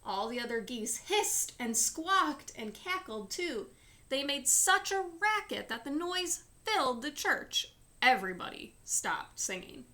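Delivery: ordinary delivery, nothing marked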